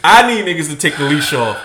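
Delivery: ordinary talk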